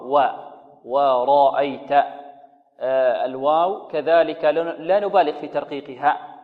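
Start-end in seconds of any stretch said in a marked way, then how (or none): 0.8-1.9 ورأيت with a light waw and a heavy ra. Shown as a demonstration. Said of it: "الواو هنا مرققة". (ورأيت بواو مرققة)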